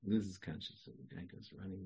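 ordinary talk, nothing marked

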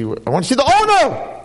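indignant demand rising high on "owner"; high-pitched, worked-up complaint; demanding customer. Acted. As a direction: play an irate shopper insisting on the boss.

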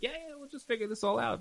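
put-on sing-song like a carefree kid. Rabbi mimicking couples as naive kids, high and childish. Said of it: "Just like kids".

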